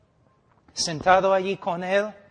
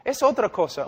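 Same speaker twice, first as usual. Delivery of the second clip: ordinary talk, nothing marked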